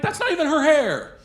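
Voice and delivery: high, loud disbelieving shout; shocked outburst at her excuse; incredulous yell. Retold or imitated.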